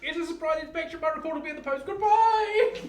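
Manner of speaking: high, loud cheeky farewell; cheeky inspector call. Acted. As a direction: call out smugly while running off. Fleeing fake inspector calls back.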